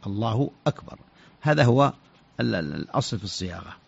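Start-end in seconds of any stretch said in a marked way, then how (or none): 0.1-1.0 الله أكبر as "Allahu; clear cut hamza. Shown as a demonstration. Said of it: "همزة قطع".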